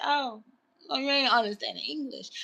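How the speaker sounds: calm, quiet. Retold or imitated. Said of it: "I'm talking just like this".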